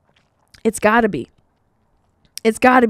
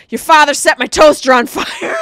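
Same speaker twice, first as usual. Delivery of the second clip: loud, high furious yell; angry friend's shout; 6 semitones higher, 10 dB louder than usual. Retold or imitated. Friend yelling on the phone about the toaster, furious shout. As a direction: blast it like a panicked friend. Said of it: "Joe Georgiana goes".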